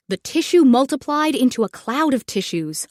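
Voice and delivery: ordinary delivery, nothing marked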